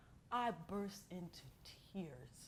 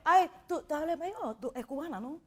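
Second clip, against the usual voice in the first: surprised clerk; 8 semitones higher, 12 dB louder, faster than usual. Retold or imitated. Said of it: "I went".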